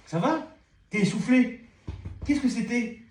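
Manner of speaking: high cooing pet voice; high tender talk to a breathless dog. Real, not acted.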